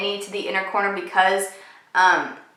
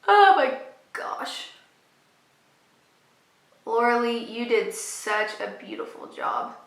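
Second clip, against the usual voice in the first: awed; 8 dB quieter than usual. Real, not acted. Awed by palette colour.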